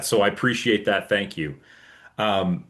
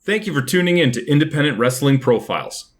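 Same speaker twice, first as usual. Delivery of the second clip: podcast outro; 3 semitones higher, 4 dB louder than usual. Acted.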